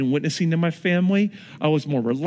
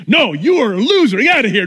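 loud, high mock rejection; mock bouncer; 8 semitones higher, 8 dB louder, faster than usual. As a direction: shove the visitor off with words. Usher shouting refusal.

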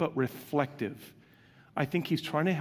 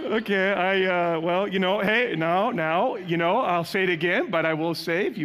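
flustered mock reply; 5 semitones higher, 6 dB louder than usual. Mock flustered quote of congregant.

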